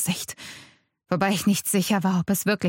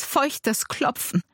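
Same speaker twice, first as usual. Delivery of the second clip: usual speaking voice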